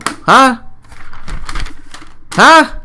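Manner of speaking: rising, baffled yelps; incredulous huh. Acted. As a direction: squeal it like you can't believe it. Mock bewildered rant.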